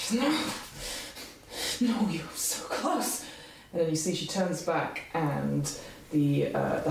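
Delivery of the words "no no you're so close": high, slow and strained, almost there; straining, desperate Vicky. Acted. Vicky pulling at the door, desperate and strained. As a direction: urge herself on as she pulls.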